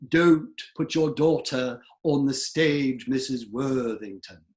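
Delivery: slow, rounded-forward standard British; standard British accent. Shown as a demonstration. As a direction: overdo a Received Pronunciation accent, lips pushed forward. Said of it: "sometimes called standard British".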